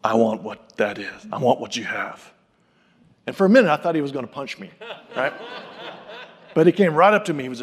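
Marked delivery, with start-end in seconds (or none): 0.0-2.3 low, slow, earnest voice of a very serious big man; lightly voiced, grave request from a towering stranger. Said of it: "he had a very serious look".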